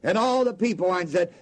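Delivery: ordinary talk, nothing marked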